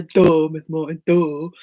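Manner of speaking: mock yourself playfully; teacher mocking her own obvious remark; self-mocking duh. Real, not acted. Mocking herself.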